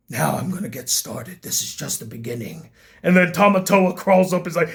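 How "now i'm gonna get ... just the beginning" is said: much lower and softer, ominous; a hushed threat from a villain; low, quiet villain teaser; menacing villain. Retold or imitated. Quoting the villain's ending line.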